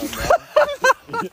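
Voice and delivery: high squeaked mock no. Teasing friend mimics his refusal in a squeaky voice. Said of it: "you're like, you know".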